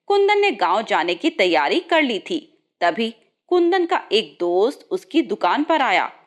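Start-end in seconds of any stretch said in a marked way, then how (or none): none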